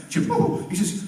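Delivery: usual speaking voice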